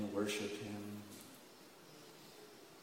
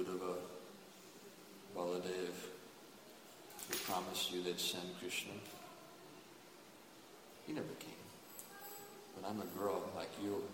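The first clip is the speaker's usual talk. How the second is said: soft reassurance; 4 semitones lower, 6 dB quieter than usual. Acted. Subhadra reassures the gopis.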